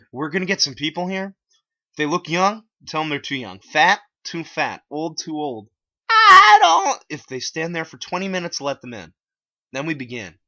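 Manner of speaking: high, loud bossy voice; hammed-up high gatekeeper voice; Clyde's voice. A narrator's reading. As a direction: lay down the rules like a bossy leader. Clyde lays out rules.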